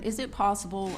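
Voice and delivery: ordinary talk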